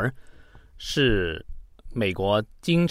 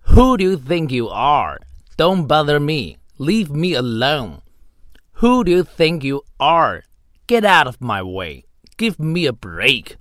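angry lines read at normal pace, higher and louder; medium-pace read; 4 semitones higher, 3 dB louder than usual. Shown as a demonstration. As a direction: fire off the insults at medium speed. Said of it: "我再用中等语速读一次". (中等语速、带怒气地读一遍)

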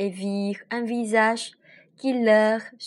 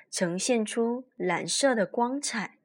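ordinary delivery

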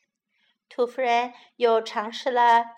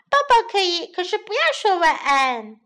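put-on child pleading; 7 semitones higher, 7 dB louder, faster than usual. Child bargaining.

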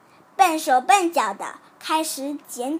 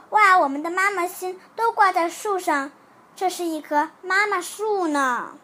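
put-on higher, louder excited child; delighted; 4 semitones higher, 4 dB louder than usual. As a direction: exclaim in happy wonder. Child delighted at the full tree. (惊喜兴奋地感叹)

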